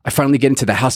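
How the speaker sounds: ordinary talk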